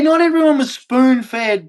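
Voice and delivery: ordinary talk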